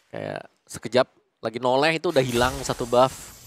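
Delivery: normal talking voice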